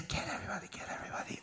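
subliminal whisper; breathy hidden whisper. Retold or imitated. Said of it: "some kind of whispers".

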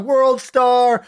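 high, excited call of approval; hyped cheer. Retold or imitated. Listener hyping up a speaker in the crowd, excited cheer. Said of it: "This is a, this is such a hype monologue".

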